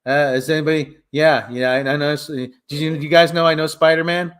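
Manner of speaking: lightly voiced low braggart; Flash Thompson. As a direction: blurt it out low, fishing for attention. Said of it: "Flash Thompson's like".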